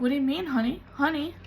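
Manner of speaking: high, sing-song "honey"; lightly voiced local woman; sweet honey voice. Woman comforting her in the bathroom, sing-song sweet. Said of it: "they say honey a lot".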